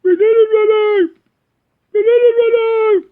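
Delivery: very high, loud mock screech; hammed-up falsetto mum yell; mum shouting upstairs. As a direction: holler up the stairs like a mum. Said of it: "my mum shouting up the stairs".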